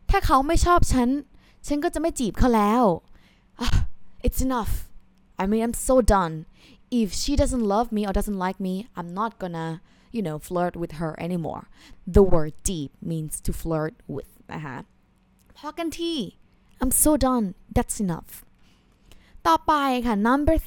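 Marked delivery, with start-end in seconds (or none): 0.1-2.8 higher, louder, fast huffy line; frustrated resolve. Shown as a demonstration. Jilted suitor giving up flirting, huffy and frustrated. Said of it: "you can say". 15.6-16.1 high, soft "พอกันที" let out with a sigh; fed-up sigh. Shown as a demonstration. Teacher sighing a fed-up "พอกันที", high and soft. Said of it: "you can say พอกันที".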